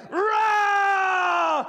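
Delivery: high drawn-out roaring yell; lion roar shout. Acted. Preacher voicing the beast's roar at the sheep lion, long shout. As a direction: roar it like a lion across the river.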